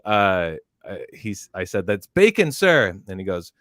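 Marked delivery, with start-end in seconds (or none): 1.9-3.0 raised, loud military reply; recruit's shouted answer. Retold or imitated. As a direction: sound off dutifully to the instructor. Recruit answering drill instructor.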